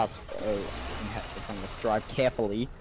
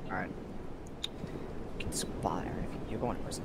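unvoiced, softer hushed whisper; 4 semitones lower, 7 dB quieter than usual. Real, not acted. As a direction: breathe it out secretly. Sneaking up, whispered.

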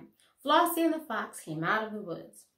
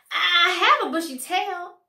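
eager claim; 8 semitones higher, 8 dB louder than usual. A narrator's reading. Fox excited idea.

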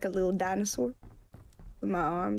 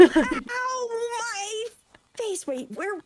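high yelp of pain; real ow, high; startled yelp after a knock; sudden pain; 10 semitones higher, 4 dB louder than usual. Real, not acted. Real pain after hitting head.

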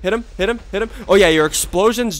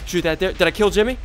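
ordinary talk

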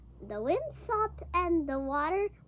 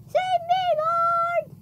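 high loud plea for help; desperate cry; 11 semitones higher, 6 dB louder, much slower than usual. Acted. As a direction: scream for rescue while sinking. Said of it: "he cried".

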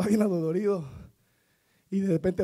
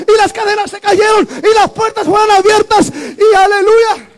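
building to loud, high shouted preaching; preaching at full force; 8 semitones higher, 11 dB louder than usual. Acted. Preacher stirring the church about Paul and Silas, shouting and building. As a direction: rise and roar it to the congregation.

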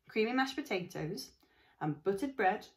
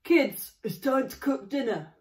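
louder, higher call across the house; holler; 6 semitones higher, 10 dB louder than usual. Acted. Father calling his kids to dinner, loud holler.

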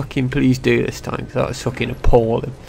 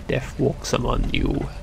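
dark villain voice; 8 dB quieter, slower than usual. Retold or imitated. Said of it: "he's a bit fucking dark".

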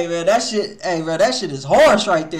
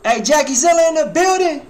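loud, high hype call-out; hype shout-out; 10 semitones higher, 8 dB louder than usual. Real, not acted. Streamer hyping his friends to chat with loud, high shout-outs. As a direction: announce them like they just walked in.

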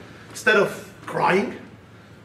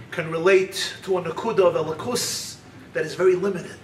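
normal talking voice

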